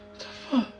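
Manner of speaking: stunned whisper; shocked whisper. Acted. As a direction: breathe it out in disbelief. Realises he was scammed.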